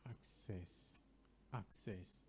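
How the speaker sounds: "Access" with a K, low and soft; correct pronunciation. Shown as a demonstration. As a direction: sound K then S in "Access". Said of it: "help us with the pronunciation".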